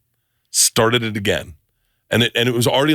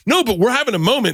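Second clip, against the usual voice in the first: pleading; 12 semitones higher, faster than usual. Retold or imitated. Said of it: "I was like".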